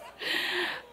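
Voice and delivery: a high, airless giggle; breathless high giggle. Real, not acted. To the audience about falling on the golf course, a high breathless giggle. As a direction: giggle through the memory of falling. Laughs recalling the fall.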